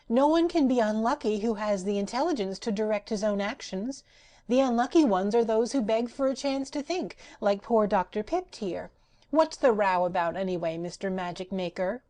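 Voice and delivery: high, bright doll voice; Patchwork Girl. Acted. Rag doll lecturing Ojo and the magician, high and cheeky.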